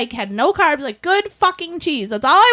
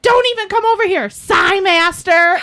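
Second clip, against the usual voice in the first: indignant warning; 6 semitones higher, 4 dB louder than usual. Retold or imitated. Telling off the sighing man.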